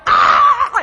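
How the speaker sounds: high, loud mock scream with a laugh; mock falling scream. Falling climber screaming, hammed-up and laughing. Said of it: "You yell".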